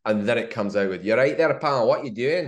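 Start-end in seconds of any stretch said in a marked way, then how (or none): 1.0-2.5 put-on broad Scottish accent, raised and punchy; a kid's Sheriff of Nottingham in Scottish. A ten-year-old plays the sheriff in a Scottish accent. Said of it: "in a Scottish accent".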